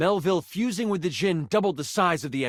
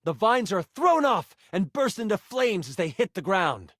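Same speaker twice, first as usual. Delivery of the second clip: usual speaking voice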